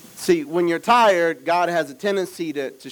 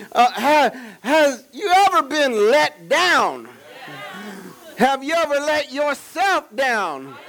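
high, drawn-out preacher questions; full-force preaching; 10 semitones higher, 4 dB louder, slower than usual. Real, not acted. Preacher asking the church if they've been let down, full-force preaching. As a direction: cry each question out slowly to the whole congregation.

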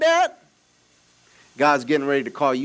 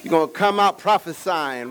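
usual speaking voice

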